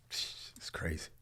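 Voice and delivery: real emotion, nearly no voice; choked-up whisper. Real, not acted. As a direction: let it fall to a whisper, overcome. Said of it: "with emotional tears now".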